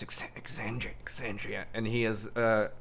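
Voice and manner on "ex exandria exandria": hushed, half-whispered mutter. Acted. Sage puzzles over a name in his book, muttering. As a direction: mutter it under your breath while reading. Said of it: "and he mutters".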